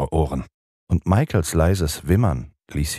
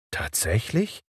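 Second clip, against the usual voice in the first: softer, mildly astonished question; 5 semitones higher, 6 dB quieter than usual. A narrator's reading. Peter answers John, mildly astonished. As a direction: ask in mild surprise. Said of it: "fragte Peter erstaunt".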